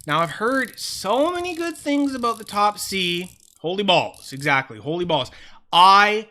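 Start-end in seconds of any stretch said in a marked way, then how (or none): none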